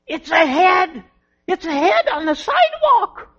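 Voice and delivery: high, loud shriek of shock; retold scream. Lady on the sidewalk screaming in shock. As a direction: shriek it out like a startled passer-by. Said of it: "a lady screamed".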